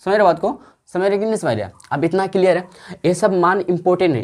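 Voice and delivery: ordinary talk, nothing marked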